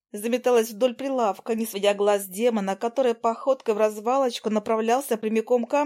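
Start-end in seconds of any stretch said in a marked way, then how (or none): none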